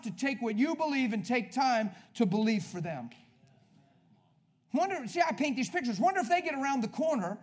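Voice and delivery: high, loud preaching, building; fiery preaching. Acted. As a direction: preach it at full force to the crowd. Preaching at full force.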